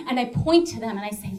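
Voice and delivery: ordinary talk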